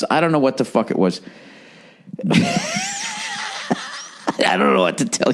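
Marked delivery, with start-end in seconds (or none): 2.1-4.0 long, very high squealing wheeze; helpless high laugh trailing into breath; high wheezing laugh. Real, not acted. Storyteller cracking up over his friend's near fatality, high wheezing laugh.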